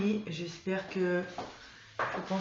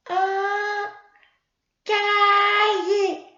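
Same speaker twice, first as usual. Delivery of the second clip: put-on much higher, louder, slow child puppet voice; Mimi; 11 semitones higher, 9 dB louder, much slower than usual. Mimi the puppet answering her teacher, high child voice. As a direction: answer slowly like an eager little puppet.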